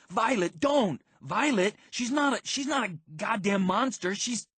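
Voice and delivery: high, loud, desperate protest; urgent pleading. A narrator's reading. Narrator begs Violet to stop attacking Quendy, urgent pleading.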